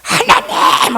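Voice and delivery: high, drawn-out call to God; long demo cry; demonstrated cry out. Shown as a demonstration. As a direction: show the congregation how to cry out. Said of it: "하고 소리 질러봐".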